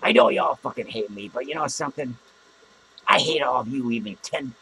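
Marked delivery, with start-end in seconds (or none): none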